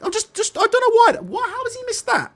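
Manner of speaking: can't believe he missed it, voice up high; incredulous. Real, not acted. Disbelief at reporter missing it.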